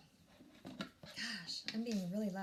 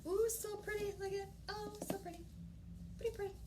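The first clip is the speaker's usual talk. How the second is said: much higher, cooing over it; cooing delight; 9 semitones higher, much faster than usual. Real, not acted. Vlogger cooing over a pretty item, high and delighted. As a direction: coo like it's the prettiest thing.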